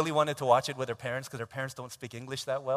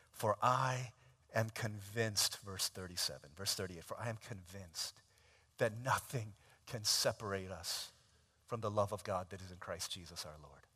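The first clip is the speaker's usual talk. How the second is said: soft scripture reading; 6 semitones lower, 5 dB quieter than usual. Acted. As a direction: read the verse softly, reverently.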